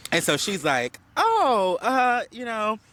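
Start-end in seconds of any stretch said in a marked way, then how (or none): 1.2-2.8 flustered dodge. Retold or imitated. Huda dodging Jeremiah's question about the other room, flustered high stall. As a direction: caught off guard, high and flustered.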